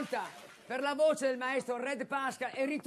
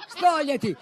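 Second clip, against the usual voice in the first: shouting; 3 semitones higher, 9 dB louder than usual. Acted. Impersonating Alessia on stage.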